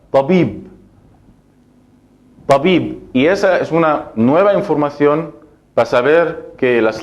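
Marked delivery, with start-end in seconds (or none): 0.1-3.1 Tabib with final B stopped, no vowel after; sukun model. Shown as a demonstration. Teacher models the silent final vowel for students. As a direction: end Tabib on a bare B. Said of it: "Se pronuncia la letra y ya está".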